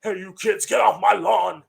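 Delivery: mock old-man shout at kids; grumpy old man. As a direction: shake your fist at the kids off the porch. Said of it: "curmudgeon-y old man, being like".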